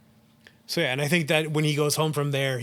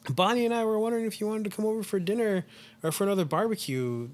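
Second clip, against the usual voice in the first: higher, lightly voiced friendly boss; sociable boss; 7 semitones higher than usual. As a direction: invite them over as a chummy boss. Boss voiced inviting employee to barbecue.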